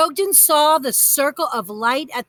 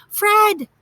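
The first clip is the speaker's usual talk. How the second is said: higher, louder joyful greeting; exclaiming; 7 semitones higher, 4 dB louder, much slower than usual. A narrator's reading. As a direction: greet old friends with delight. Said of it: "Brogden exclaimed".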